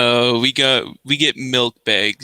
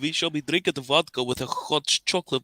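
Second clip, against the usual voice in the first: Russian accent; 4 dB quieter than usual. Shown as a demonstration. Showing friends a best impression about motherland and vodka, Russian accent. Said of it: "that's my best impression of the Russian accent".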